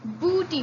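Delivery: Booty with a firm hard b. Shown as a demonstration. Teacher repeats the hard b for students. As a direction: press the b of Booty. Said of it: "Say it".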